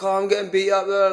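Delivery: mock panic from a fighter who has just been dropped; panicky mock whine. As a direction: whine it like you're falling apart. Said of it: "don't then be like".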